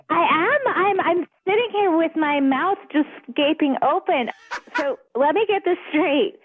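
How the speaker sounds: usual speaking voice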